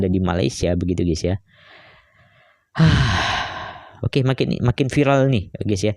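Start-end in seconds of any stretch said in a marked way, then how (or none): 2.8-3.7 long falling sigh; heavy sigh. Real, not acted. YouTuber pausing after discussing the case, heavy sigh. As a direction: let out a long, audible breath.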